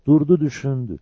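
usual speaking voice

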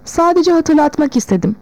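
mildly impatient. A narrator's reading. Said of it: "İhtiyar Tivim sabırsızlanarak".

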